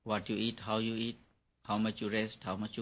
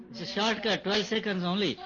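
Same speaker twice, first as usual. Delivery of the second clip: laughing; 11 semitones higher than usual. Real, not acted. Teacher laughing at his joke.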